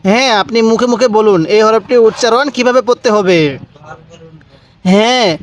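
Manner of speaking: normal talking voice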